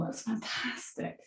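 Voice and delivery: soft breathy whisper of encouragement; whispered praise. Real, not acted. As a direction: whisper it warmly to the class. Instructor praising the class softly.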